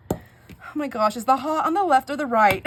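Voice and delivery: loud, high, panicked call; yelled question. Retold or imitated. Asking husband which tap is hot, loud yell. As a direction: shout it through to the other room. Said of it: "I yelled out".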